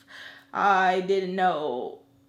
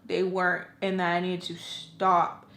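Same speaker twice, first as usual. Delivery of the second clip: ordinary talk, nothing marked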